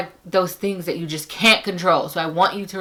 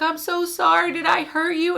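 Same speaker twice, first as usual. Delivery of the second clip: high apologetic nurse; 13 semitones higher than usual. Retold or imitated. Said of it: "she's like".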